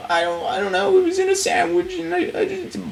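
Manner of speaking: high, flustered cartoon kid; Morty. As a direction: fumble the words like a scared teen. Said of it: "Morty voice again".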